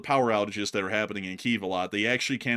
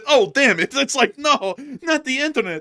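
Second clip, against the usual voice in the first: high, loud comic frustration; hammed-up, pitched-up complaint; mock dismay; 12 semitones higher, 8 dB louder than usual. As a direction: play a soldier cursing a dead connection. Voicing soldiers who lost connection.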